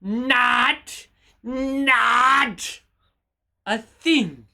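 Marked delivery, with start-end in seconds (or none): 0.0-2.8 "not" repeated alone, drawn out; stress on NOT. Shown as a demonstration. Streamer drilling chat on the correction, emphatic "not". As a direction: punch each "not" as its own word. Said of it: "emphasis on not".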